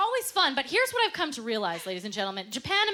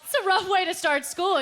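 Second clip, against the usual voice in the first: normal talking voice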